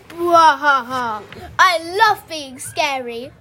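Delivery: loud, slow, deep monster laugh; villain laugh. Acted. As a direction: boom it out like a storybook monster. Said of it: "laughed Alan".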